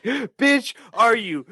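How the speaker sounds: mock stoned viewer. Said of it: "being like".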